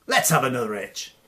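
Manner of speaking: swaggering loud taunt; cocky taunt. Acted. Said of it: "says Tom".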